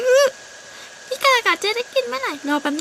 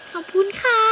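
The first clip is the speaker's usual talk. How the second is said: put-on little girl thanks; 7 semitones higher than usual.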